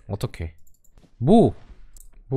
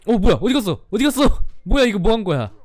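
startled; 8 semitones higher, 4 dB louder than usual. Real, not acted. Opponent vanishes mid fight.

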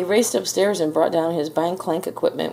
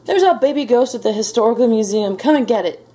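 higher, louder, insistent delivery; agitated demand; 5 semitones higher, 5 dB louder than usual. A narrator's reading. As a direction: insist as an irate caller. Irate man phoning the police.

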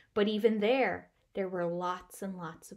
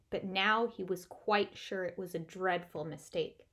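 usual speaking voice

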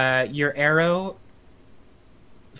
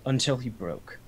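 cold vampire lord; 3 semitones higher, 5 dB louder, slower than usual. Acted. DM voicing Strahd.

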